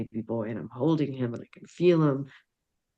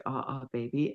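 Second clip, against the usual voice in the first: soft, hushed cooing to a newborn; tender baby coo; 6 dB quieter than usual. Retold or imitated. Little girl cooing softly to her new baby brother. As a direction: coo gently over the baby. Mother retells baby greeting.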